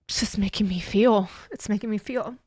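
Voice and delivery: a breathy, tear-choked whisper. Real, not acted. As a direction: barely get the words out, overcome. Overcome with emotion, voice drops.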